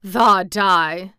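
put-on loud, scoffing old Yorkshireman; exultant country disbelief; dry exultation. A narrator's reading. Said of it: "said with dry exultation".

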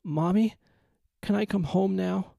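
put-on high, crying child's plea; boy asks his mother, crying; tearful plea. A narrator's reading. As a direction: beg through tears to come home.

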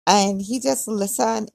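ordinary delivery

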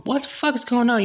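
high, shocked yell at the cheater; furious girlfriend. Retold or imitated. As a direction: catch him in the act and explode. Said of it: "Girlfriend come home".

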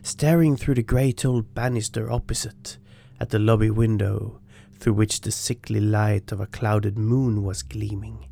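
ordinary delivery, nothing marked

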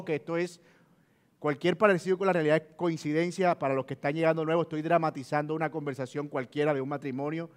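ordinary talk, nothing marked

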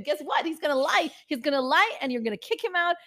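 high-pitched, taunting prediction; goading inner voice. Retold or imitated. Her inner voice telling her to leave him, goading. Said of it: "That's literally my voice".

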